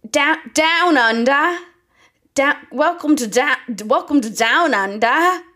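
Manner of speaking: loud, slow, hammed-up Aussie accent that drifts toward German; bad Australian accent. Mouse patient asked by his therapist for a new accent, loud slow mock Australian. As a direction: boom out a clumsy fake Australian welcome. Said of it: "Okay, so that was German".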